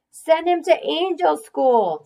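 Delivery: put-on high, slow piping child's voice; piped-up kid. A narrator's reading. Kid calling out a joke in class, high piping. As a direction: pipe it up from the back. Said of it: "piped up".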